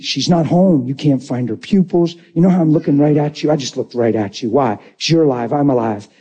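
usual speaking voice

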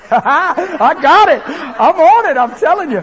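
loud, high laughing exclamation; elated shout with a laugh; triumphant excitement. Real, not acted. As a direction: crow with real delight at getting it. Delighted at remembering the woman's name.

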